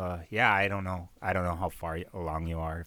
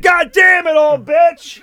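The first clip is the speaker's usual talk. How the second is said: much higher, much louder yell; mock furious yell at grandma; joking shout; 15 dB louder, slower than usual. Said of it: "I said".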